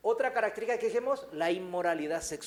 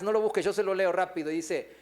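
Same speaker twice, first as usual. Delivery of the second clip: ordinary talk, nothing marked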